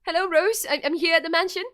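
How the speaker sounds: high, loud, exasperated demand; acted frantic calling; urgent phone call. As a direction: raise your voice down a bad phone line.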